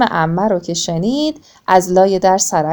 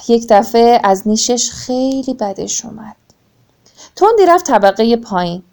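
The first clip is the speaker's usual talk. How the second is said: usual speaking voice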